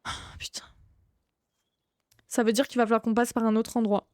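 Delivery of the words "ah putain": breathy whispered swear; whispered curse. Real, not acted. Player whispering a curse at a blocked path. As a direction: curse under your breath. Quiet curse during horror game.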